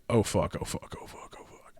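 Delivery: muttered oh fuck, fading to a breath; fading dread mutter. Retold or imitated. Kaiser dreading being sent in, muttered fading curse. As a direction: mutter it under your breath in dread, trailing off.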